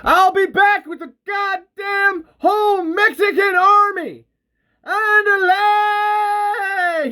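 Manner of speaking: top-of-the-lungs yell, very high; wild rebel yell; shouting full force. A narrator's reading. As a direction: holler it as you roar past, stretching the last word. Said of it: "shouting at the top of his lungs".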